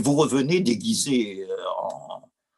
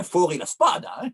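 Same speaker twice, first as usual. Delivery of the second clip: Italian spoken with a Corsican lilt, raised pitch; Corsican-accented Italian; 7 semitones higher, 3 dB louder than usual. Retold or imitated. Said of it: "mon accent corse".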